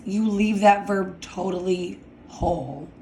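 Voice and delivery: ordinary delivery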